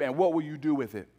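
fast, pressing question; quick challenge. Real, not acted. Pastor presses the congregation, fast and urgent.

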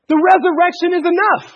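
high, loud preacher's declaration; emphatic proclamation. Real, not acted. Preacher declaring the resurrection to the congregation, emphatic. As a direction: proclaim it with full force.

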